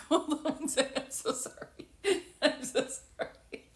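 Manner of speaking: breathless giggles through an apology; can't stop laughing while apologizing; real fit of giggles; laughing helplessly. Real, not acted. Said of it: "I cannot stop laughing".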